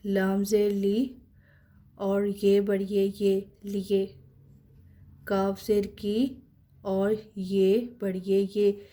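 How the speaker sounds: each letter and vowel mark named slowly, then the syllable; slow spelling drill. Shown as a demonstration. Teacher spells out syllables slowly for students. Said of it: "teacher spelling out letters".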